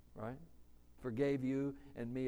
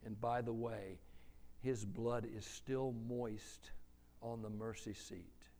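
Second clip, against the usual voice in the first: usual speaking voice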